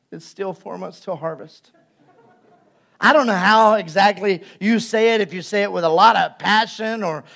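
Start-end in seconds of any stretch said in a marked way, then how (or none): 0.1-1.7 soft, defeated, lower murmur; weary resignation. Pretend. Mom facing piled-up toys, quietly resigned. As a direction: say it drained and quiet after the sigh. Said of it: "takes a deep breath and goes".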